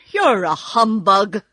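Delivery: loud, hurt accusation; grieved. A narrator's reading. Scarecrow accusing the false wizard, grieved. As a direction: accuse him loudly, wounded. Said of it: "in a grieved tone".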